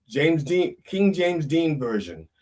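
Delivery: ordinary talk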